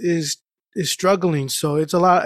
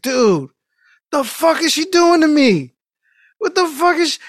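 much higher, louder pained outburst; retold panicked disbelief; 10 semitones higher, 4 dB louder than usual. Patient in dentist chair, panicking at hygienist, high pained yelp. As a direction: squeal it like the scraping is too much.

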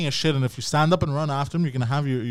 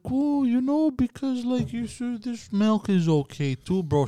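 mock soft parent; 6 semitones higher, 4 dB quieter, slower than usual. Mocking soft permissive parent.

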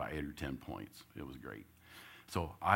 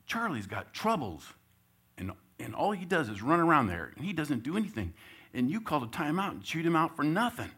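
higher, louder son's voice; son's complaint; 9 semitones higher, 5 dB louder than usual. Retold or imitated. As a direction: push back on your dad. Son voiced complaining to dad.

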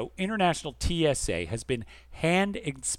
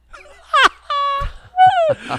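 big high laugh; 11 dB louder than usual. Real, not acted. Host reacting to a child's joke, squealing laugh. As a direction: burst of high-pitched laughter. Host cracks up at listener email.

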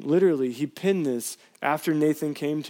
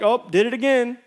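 mock shrug; 8 semitones higher, 4 dB louder than usual. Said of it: "He's not like".

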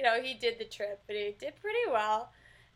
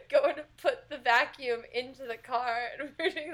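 ordinary talk, nothing marked

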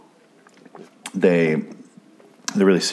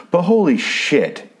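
exasperated scolding; 8 semitones higher than usual. Real, not acted. Scolding the character for recklessness.

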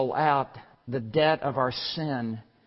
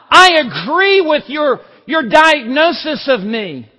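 loud and much higher, pleading; shouted confession; 13 semitones higher, 16 dB louder than usual. Acted. Sinner confessing to God, anguished shout. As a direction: cry it out in anguish.

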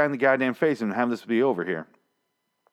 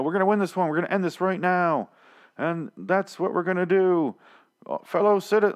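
much higher, hammed-up rallying speech; mocking Rick's speech; 9 semitones higher than usual. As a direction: parody a grandiose leader rousing the troops.

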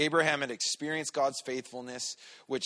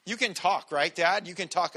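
put-on higher and louder, insistent kid's nagging; teasing little daughter; 6 semitones higher, 8 dB louder than usual. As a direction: needle Dad like a cheeky kid. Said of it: "She's like".

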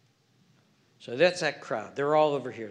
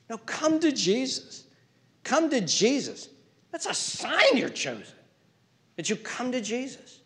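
pleading preaching; 9 semitones higher than usual. Real, not acted. Preaching plea to come.

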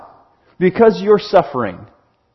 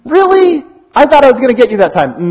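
high, louder "Really?" then quick grumble; playful letdown, pitched up; disappointed surprise; 5 semitones higher, 4 dB louder, faster than usual. Retold or imitated. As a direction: sound let down the prank failed. Brother disappointed scare failed.

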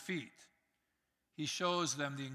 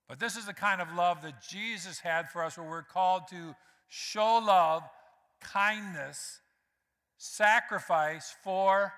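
louder, building sermon emphasis; preaching; 5 dB louder than usual. Acted. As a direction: press each point home to the church. Preaching with rising force.

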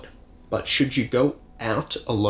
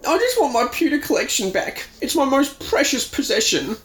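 high whiny sobbing voice; crying whine. Acted. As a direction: whimper it like a crybaby. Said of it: "the faucets open up again".

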